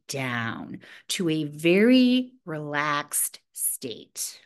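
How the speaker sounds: drawn-out, low, sinking delivery; slow and relaxed. Shown as a demonstration. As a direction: let the voice slow and sink into relaxation.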